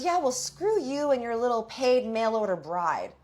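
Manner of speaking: high-pitched imitation of her jeer; mock taunting insult. Retold insult in the fight.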